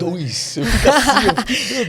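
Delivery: high, laughing words, real and unguarded. Real, not acted. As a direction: laugh through it, caught off guard. Flustered by being watched.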